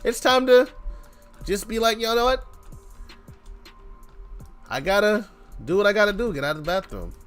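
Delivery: ordinary talk, nothing marked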